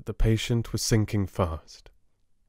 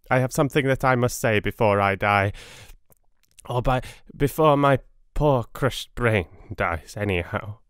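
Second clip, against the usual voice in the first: hurry, he has minutes left; urgent dying patient; 3 semitones higher than usual. Acted. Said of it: "hurriedly and with more energy".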